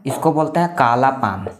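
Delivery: ordinary talk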